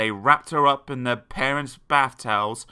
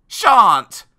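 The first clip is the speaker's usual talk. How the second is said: put-on loud, high boy's yell; one big defiant yell; defiant shout; 10 semitones higher, 13 dB louder than usual. A narrator's reading. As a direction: shout your refusal like a naughty boy. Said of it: "shouted Tristram".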